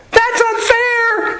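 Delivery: squealed very high and slow, a comic tantrum; mock British shriek. Teacher mocking British soldiers ambushed from the trees, shrieking high and whiny. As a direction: shriek it like an outraged redcoat. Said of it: "and they'd go".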